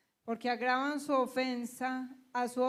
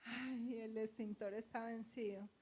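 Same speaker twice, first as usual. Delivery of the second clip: much softer, faint and sickly; weak and ill; 10 dB quieter than usual. Retold or imitated. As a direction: say it like you're about to faint. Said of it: "Y yo". (muy bajito, como a punto de desmayarse)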